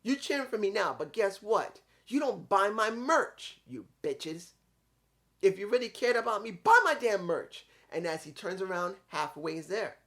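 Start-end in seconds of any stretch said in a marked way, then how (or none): 0.0-7.7 higher, louder ranting heel promo; imitating Ethan Page yelling at fans to buy his merch; mock angry promo. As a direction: rant at the crowd like a bitter wrestler. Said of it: "And he says".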